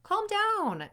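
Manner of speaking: high, loud 'calm down'; bossy command. Acted. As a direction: order someone sharply to calm down. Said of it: "Now, if somebody tells you".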